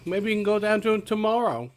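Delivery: a bad stab at an Australian accent; failed Australian accent. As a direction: try for Australian and miss. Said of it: "that was supposed to be Australian".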